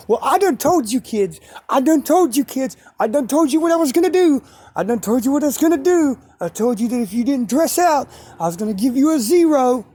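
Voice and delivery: hammed-up angry teacher scolding the class; mad yelling coach. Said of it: "He is just freaking off the wall".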